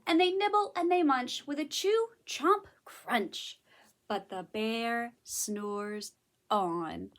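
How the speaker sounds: picture-book storytelling; story narration. A narrator's reading. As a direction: read to little children, punching the munching words. Reads a story aloud.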